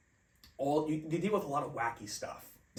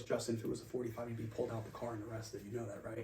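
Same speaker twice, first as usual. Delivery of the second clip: hushed warning; 5 semitones lower, 12 dB quieter than usual. Retold or imitated. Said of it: "he goes".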